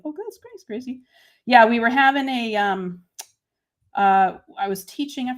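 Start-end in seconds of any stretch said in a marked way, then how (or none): 0.0-1.0 high, soft little Grammy voice; Grammy impression. Crafter mimicking her Grammy to a livestream viewer, Grammy impression. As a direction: imitate Grammy, pitched way up and quiet. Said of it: "sound just like my Grammy".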